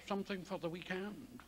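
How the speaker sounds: soft, discreet barber's offer; barber's murmur. Retold or imitated. Barber offers a customer condoms in a hushed voice. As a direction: slip it quietly over the chair. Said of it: "the line from the barber".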